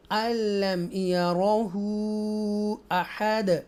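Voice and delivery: slow, drawn-out tajweed chant; Quran recitation. Shown as a demonstration. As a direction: recite each word slowly and melodically.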